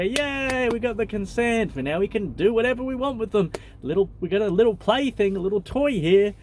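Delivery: high, gleeful mock cheer; high, fast, gloating spirit voice; gloating entities. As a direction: cheer like a tricky spirit that won. Deceptive entities gloat over consent.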